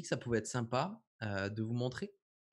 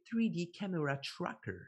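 three D Camera Tracker in a put-on English accent, a bit higher; French speaker doing an English accent; 5 semitones higher than usual. Shown as a demonstration. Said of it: "avec mon accent anglais".

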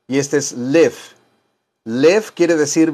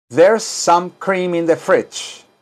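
slow, careful English; slow teaching read; slow model sentence; 3 semitones higher, slower than usual. Shown as a demonstration. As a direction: say it slowly for students to repeat. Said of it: "Ahora digan".

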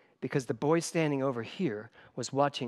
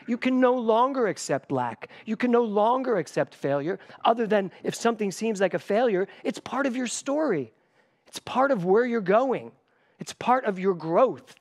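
rising preaching fervor; 8 semitones higher, 5 dB louder than usual. Real, not acted. Preaching builds to a climax.

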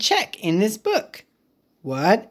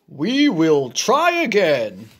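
Gerald voice; 3 semitones lower than usual. Acted. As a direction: lower big elephant voice. Gerald echoes Piggy.

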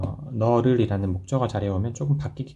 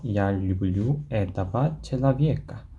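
slow model sentence; much slower than usual. Shown as a demonstration. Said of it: "한 번 더요".

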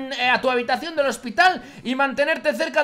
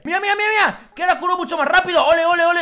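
excited; 6 semitones higher, 5 dB louder, faster than usual. Real, not acted. Excited at faster healing.

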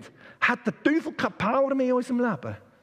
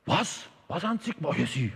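lightly voiced police sergeant, much lower through the middle; 7 semitones lower than usual. Sergeant waving off the man's confession, lightly voiced low officer. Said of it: "hat der Wachmeister gesagt".

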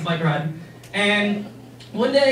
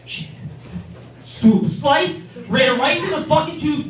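ordinary delivery

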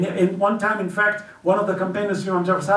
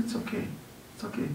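much softer, calm and forgiving; gentle reassurance; 2 semitones higher, 14 dB quieter, faster than usual. Acted. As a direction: hush it, kindly letting it go. Imam gently answers the companion.

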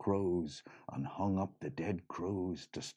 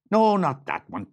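correcting leprechaun; 10 semitones higher, 9 dB louder than usual. Acted.

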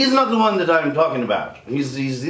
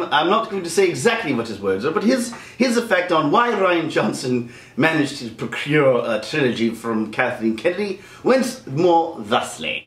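usual speaking voice

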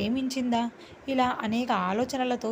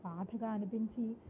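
plain reading voice

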